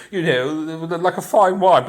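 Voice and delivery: cultured British speech. Shown as a demonstration. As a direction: overplay a refined British accent. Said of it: "I could talk cultured".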